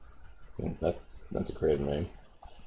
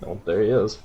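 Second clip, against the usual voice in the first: normal talking voice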